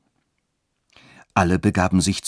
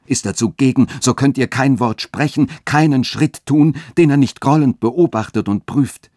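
höher und lauter, drängend warnend; eifernd; 5 semitones higher, 4 dB louder than usual. A narrator's reading. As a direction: eindringlich auf sie einreden. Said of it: "fuhr Friedrich eifernd fort". (Friedrich warnt Katharina vor dem Dechanten, eifernd und höher)

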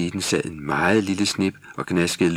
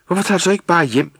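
fast, much higher and louder furious yell; angry shout; 11 semitones higher, 6 dB louder, faster than usual. Acted. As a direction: snap back at her, fed up. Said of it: "råbte han vredt".

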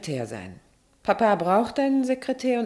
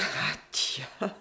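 usual speaking voice

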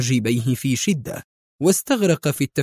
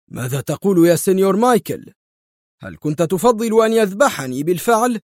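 higher-pitched indignant outcry; exclaiming; 6 semitones higher than usual. A narrator's reading. The governor challenging Michael's remark, exclaiming. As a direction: cry out in protest at the question. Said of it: "صاح الحاكم".

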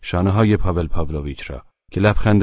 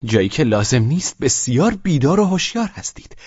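higher, sharp reproach; blurted reproach; 8 semitones higher than usual. A narrator's reading. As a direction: blurt it out in anger. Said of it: "از زبانش پرید و گفت".

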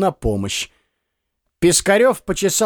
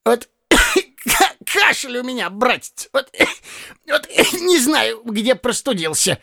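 put-on old man covering with a cough; 9 semitones higher than usual. A narrator's reading. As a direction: talk between coughs. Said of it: "начал кашлять".

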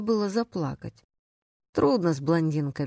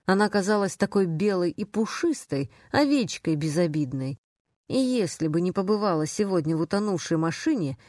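ordinary delivery, nothing marked

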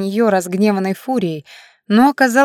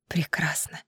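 whispering; 3 semitones lower, 11 dB quieter than usual. A narrator's reading. Лия answers in a whisper.

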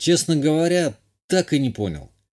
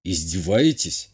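low, slow hiss of disbelief; drawn-out, lowered, indignant question; hissed indignation; 4 semitones lower than usual. A narrator's reading. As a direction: hiss it slowly under your breath. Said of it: "прошипел я".

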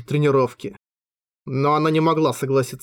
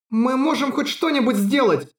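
pleading; 10 semitones higher than usual. A narrator's reading. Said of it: "В её голосе звучала мольба".

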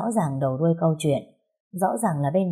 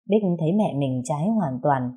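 ordinary delivery, nothing marked

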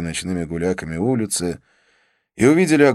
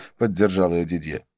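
plain reading voice

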